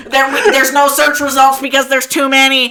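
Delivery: high, loud glitching computer voice; a brain crashing under overload. Retold or imitated. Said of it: "my brain is like".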